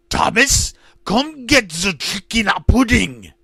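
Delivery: put-on screamed in a thick German accent. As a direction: bellow it like a German dictator. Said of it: "He screamed, his thick German accent".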